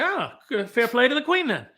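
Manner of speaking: high-pitched comic British stage voice; mock-congratulate in a high, put-on voice; playful high impersonation, pitched well up; Eddie Izzard impression. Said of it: "Eddie Izzard's gonna come out and say".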